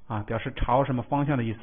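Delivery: ordinary talk, nothing marked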